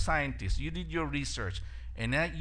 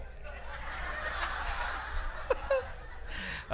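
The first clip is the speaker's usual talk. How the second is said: real high, airy laugh; 7 dB quieter than usual. Real, not acted. Pastor laughing after the joke about no hair, high airy laugh. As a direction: let out a high, breathless laugh.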